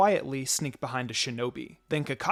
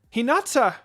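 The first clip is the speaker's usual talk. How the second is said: sharp high call of a name; alarmed cry; 9 semitones higher, 7 dB louder than usual. A narrator's reading. Narrator voicing a startled cry as the girl faints. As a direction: shout her name as she faints. Friend faints, someone cries out.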